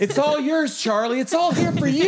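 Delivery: hammed-up generous host, squealing with laughter; playing the cool dad showing off the treats, high and laughing; mock grand offer.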